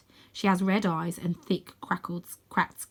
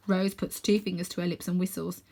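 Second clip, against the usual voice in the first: ordinary delivery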